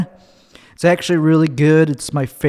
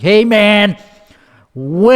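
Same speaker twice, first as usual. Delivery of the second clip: mock whine; 5 semitones higher, 3 dB louder, slower than usual. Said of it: "It's just like".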